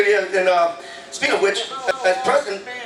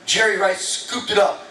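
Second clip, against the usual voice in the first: ordinary talk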